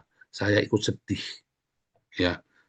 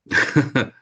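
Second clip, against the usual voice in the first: brief chuckle, raised in pitch; laugh; 5 semitones higher than usual. Real, not acted. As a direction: laugh lightly before carrying on. Preacher laughs before urging an amen. (tertawa kecil)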